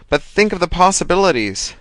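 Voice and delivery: building to a high pitch; gleeful. A narrator's reading. Thomas gleeful.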